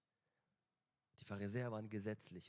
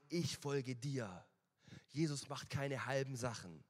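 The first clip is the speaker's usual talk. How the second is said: louder, urgent altar-call preaching; preaching at full force; 5 dB louder than usual. Acted. Preacher calling the congregation to come to Jesus, loud and urgent. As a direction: press the congregation to come forward.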